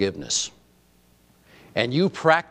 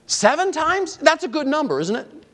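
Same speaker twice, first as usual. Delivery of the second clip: much higher, rising mock-doubtful question; Seven times? pitched way up in mock surprise; mock incredulous. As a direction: toss it up in disbelief. Preacher mocks Peter's number.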